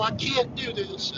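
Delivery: helpless woman. Retold or imitated. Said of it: "been around women".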